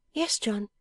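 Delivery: high, steady wifely reply; high-voiced attentive wife; dutiful wife. A narrator's reading. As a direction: answer him readily in a high voice. Wife answers, braced for news.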